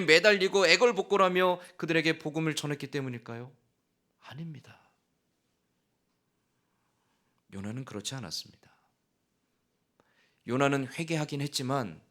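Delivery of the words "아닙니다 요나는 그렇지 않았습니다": breathy hush, fast on the second line; hushed whisper. Real, not acted. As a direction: drop to a whisper for the denial. Preacher drops to hushed denial.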